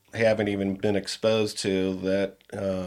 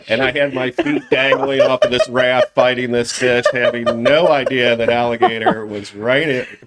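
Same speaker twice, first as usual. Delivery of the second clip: louder, telling it through laughter; laughing disbelief; 3 semitones higher, 6 dB louder than usual. Real, not acted. Telling friends about the unseen alligator, laughing in disbelief. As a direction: laugh at your own close call. Laughing at his narrow escape.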